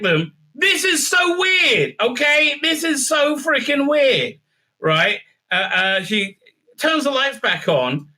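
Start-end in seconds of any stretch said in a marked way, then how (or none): 0.5-4.4 high, drawn-out incredulous cry; exasperated disbelief. Real, not acted. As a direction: stretch it out, baffled by the absurdity. Exasperated reaction to a bizarre plot.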